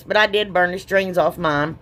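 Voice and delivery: ordinary talk, nothing marked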